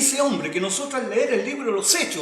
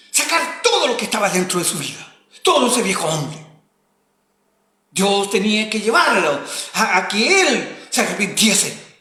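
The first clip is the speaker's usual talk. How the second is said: louder, urgent sermon delivery; forceful preaching; 3 semitones higher, 7 dB louder than usual. Acted. Preacher urging the congregation, forceful and loud. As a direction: press the old man out of them.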